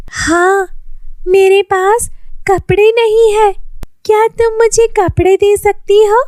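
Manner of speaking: put-on poor girl asking Lily for clothes, high pleading voice. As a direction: ask timidly like a poor child.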